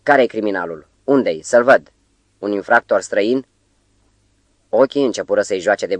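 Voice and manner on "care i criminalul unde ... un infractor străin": ordinary delivery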